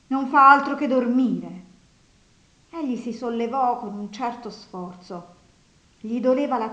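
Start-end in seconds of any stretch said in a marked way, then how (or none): 0.1-1.6 loud exasperated scolding; indignant complaint. A narrator's reading. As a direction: complain loudly at the sleeping man. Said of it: "esclamò lei indignata". (lamentela indignata ad alta voce)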